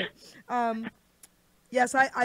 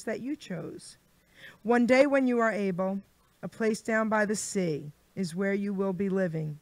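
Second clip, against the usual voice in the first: a lightly performed poem reading, a bit louder and rhythmic; poem recital; 5 dB louder than usual. Acted. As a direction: recite the rhyming verses to the guest with playful flair. Reads her poem on air.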